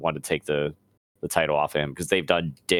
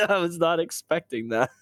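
words broken by laughter; laughing; 10 semitones higher than usual. Real, not acted. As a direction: laugh through the line. Cracking up after the joke.

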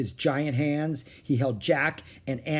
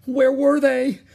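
high, loud, panicked question; frantic; 12 semitones higher, 9 dB louder than usual. Acted. Jack feeling for his lost glasses, frantic. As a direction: panic while searching.

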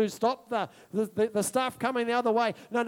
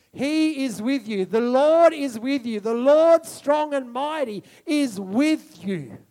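swelling louder into a slow, loud declaration; building preaching; 4 semitones higher, 7 dB louder, slower than usual. Acted. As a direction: climb to a full proclamation.

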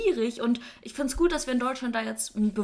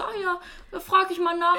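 higher, casual mock granny; a hint of breezy old lady, pitched up; lightly voiced granny; 8 semitones higher, 3 dB louder than usual. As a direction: imitate a granny's harmless curiosity. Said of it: "Für die Oma war es wahrscheinlich so".

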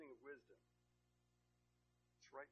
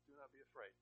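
gentle reassurance; 10 dB quieter than usual. Acted. Jesus comforting, gentle quote.

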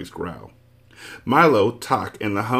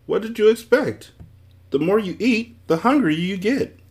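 lightly voiced, higher know-it-all magician; math magician voice; 12 semitones higher than usual. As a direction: play the smug magician, pitched up.

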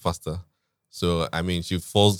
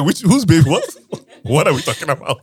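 louder, higher, laughing through the words; cracking up at his own shock; laughing disbelief; 8 semitones higher, 8 dB louder than usual. Real, not acted. Husband laughing as he retells his shock at the news.

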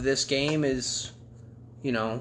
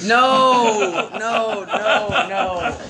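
shouting no; 7 semitones higher, 7 dB louder, much slower than usual. Real, not acted. Panicked denial, laughing.

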